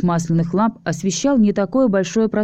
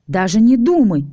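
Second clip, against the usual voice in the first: higher, louder jealous shout; 4 semitones higher, 4 dB louder than usual. Acted. Alisa shouting at Tanya over the kiss, jealous yell. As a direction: cut her off with a yell. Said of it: "вновь крикнула Алиса".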